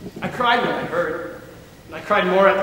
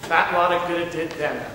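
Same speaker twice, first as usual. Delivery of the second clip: usual speaking voice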